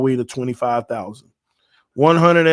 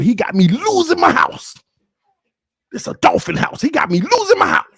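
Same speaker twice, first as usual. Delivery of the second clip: mock outrage; 12 semitones higher, 4 dB louder than usual. Real, not acted. Mock despair at Tua.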